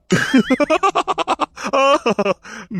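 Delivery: long, high villainous laugh; villain revelling in his own boast; gloating laugh. Acted. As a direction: cackle high and drawn out.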